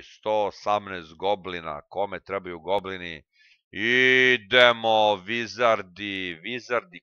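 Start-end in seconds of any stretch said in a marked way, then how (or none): 3.7-7.0 drawn-out hype call. Pretend. Calling his wizards out.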